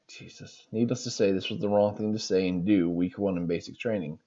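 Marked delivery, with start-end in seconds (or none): 0.1-0.7 breathy whispered Jesus; whispered disbelief. Real, not acted. Reader reacts to the omelet story in a whisper.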